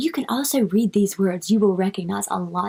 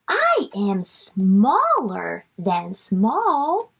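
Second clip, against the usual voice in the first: drawn out sounded-out words; slow reading for kids; much slower than usual. Shown as a demonstration. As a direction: read each word slowly. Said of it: "bug reading line".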